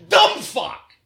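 high, sharp insult spat out; exasperated high-pitched insult; angry outburst. Real, not acted. Venting at friend.

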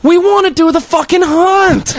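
high, loud, slow mock kid shout; whiny yell of bratty kids; kids protesting. Kids demanding to keep the hunt going, loud and high.

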